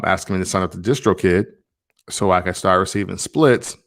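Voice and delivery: ordinary talk, nothing marked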